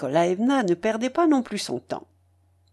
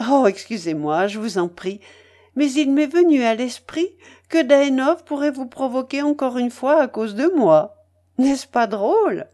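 louder, higher playful lady; lively Madame Polosov, amused; 5 semitones higher, 5 dB louder than usual. Acted. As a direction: tease him with amused, animated energy. Voices amused Madame Polosov in story. (dame enjouée, voix haute et vive)

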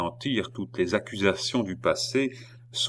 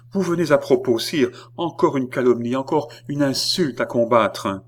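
high, louder impassioned appeal; crying out; 6 semitones higher, 5 dB louder than usual. A narrator's reading. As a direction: exclaim it urgently to the king. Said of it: "s'écria celle-ci". (appel exclamé, voix haute)

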